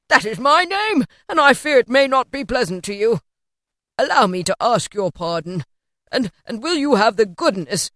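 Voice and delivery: put-on old man eagerly begging pardon; effusive Scrooge, apologetic. A narrator's reading.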